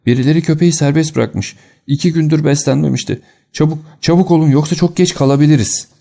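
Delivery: high, urgent cry of warning; alarmed shout. A narrator's reading. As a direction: raise the alarm, pitched well up and pressing. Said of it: "diye bağırdı".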